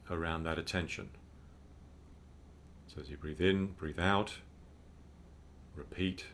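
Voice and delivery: calm, unhurried meditation guide; measured mindfulness instruction with spacious pauses; guided meditation. Real, not acted. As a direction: guide listeners gently into breathing, with long pauses.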